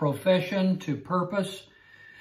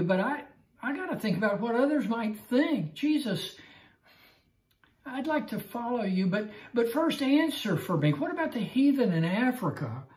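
higher, whiny excuses to Jesus; wheedling excuses; excuse-making believer; 5 semitones higher, faster than usual. Acted. Believer making excuses to Jesus, higher and whiny.

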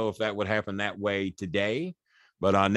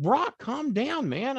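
higher, slow pleading; slow it right down to calm him; retold pleading; 13 semitones higher, much slower than usual. Man pleading with an angry wrestler, high and slow.

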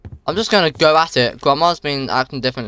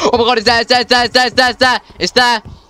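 high, loud gasping shriek; real jump-scare screaming, near tears; terrified panic; 10 semitones higher, 4 dB louder, faster than usual. Real, not acted. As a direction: repeat it in rapid, frantic bursts. Monster appears, player panics.

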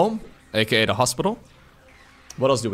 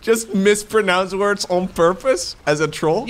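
laughing; 6 semitones higher, slower than usual. Real, not acted.

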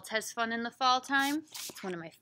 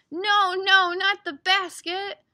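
loud, high, stretched protest; big, slow "no, no"; storybook alarm; 9 semitones higher, 13 dB louder, slower than usual. Acted. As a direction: cry out to stop the puppy. Stops puppy in story.

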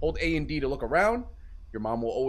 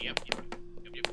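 whisper; 9 dB quieter than usual. Real, not acted. Hiding from mom, whispering.

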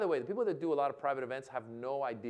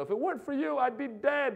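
high, hammed-up grateful survivor; testimonial speaker; 11 semitones higher, 4 dB louder than usual. Saved person to the charity, mock-emotional. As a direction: push it up high and louder, imitating a testimonial. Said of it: "it becomes very sad and somber".

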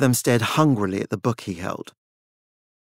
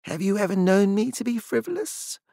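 level; 9 semitones higher than usual. A narrator's reading. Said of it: "met her gaze levelly".

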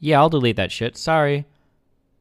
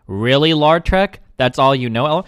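normal talking voice

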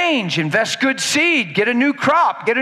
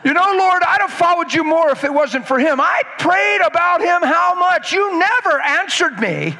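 whiny excuse-maker; 8 semitones higher than usual. Acted. Believer blaming spouse before the Lord, high whining. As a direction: whine your excuse to God.

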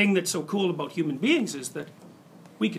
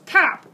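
mock tap sound; 9 semitones higher, 7 dB louder than usual. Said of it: "Someone comes along and goes".

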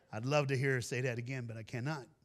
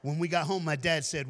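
usual speaking voice

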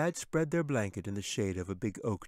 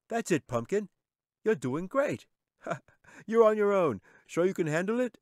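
high-pitched, delighted cheering-on; proud, excited parent calling out; encouraging dad; 11 semitones higher, 3 dB louder than usual. A narrator's reading. Dad cheering on kite-flying daughter.

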